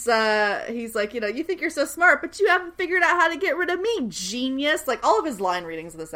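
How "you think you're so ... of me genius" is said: higher-pitched, needling taunt; mocking taunt. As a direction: taunt him as the cleverer one. Acting out Siler taunting Matt.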